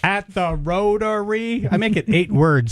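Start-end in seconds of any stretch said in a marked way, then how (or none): 0.0-1.7 slow, stiff robot-like "Rotary"; mock robotic caller. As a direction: drag it out like a robot. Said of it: "imitating own recording".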